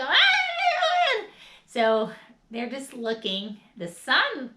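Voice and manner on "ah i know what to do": very high, loud laughing shout; hammed-up tantrum yell; adult tantrum wail. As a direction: bawl like a grown man throwing a fit. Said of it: "seeing the adult go".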